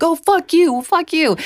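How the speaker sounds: high, hammed-up bro voice yelling back; mocking the dudes. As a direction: play the jeering guys in the Jeep, high and mocking.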